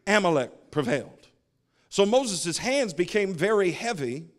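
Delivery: slow, emphatic pulpit reading; scripture reading. Real, not acted. Preacher reading Moses and Joshua to the church, declaimed scripture. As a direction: read the verse out boldly, phrase by phrase. Reads scripture aloud to congregation.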